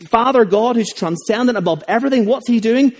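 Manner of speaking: ordinary delivery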